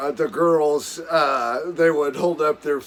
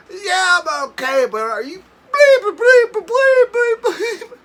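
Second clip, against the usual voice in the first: very high and loud, outraged bleeped question; hammed-up furious friend in the water; mock indignant yell; 9 dB louder than usual. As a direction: shout it back up the cliff, offended.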